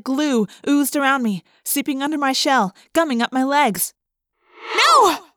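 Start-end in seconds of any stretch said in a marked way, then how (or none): 4.8-5.3 high, loud panicked scream. Acted. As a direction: scream in sudden terror. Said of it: "I screamed".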